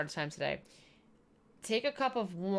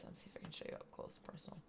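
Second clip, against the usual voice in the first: whisper; 17 dB quieter, faster than usual. Real, not acted. Whispering up close to camera.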